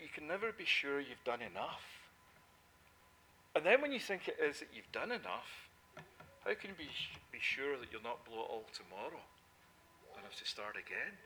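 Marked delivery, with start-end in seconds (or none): none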